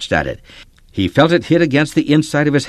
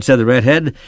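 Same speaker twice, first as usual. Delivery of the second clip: ordinary delivery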